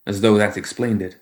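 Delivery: ordinary delivery, nothing marked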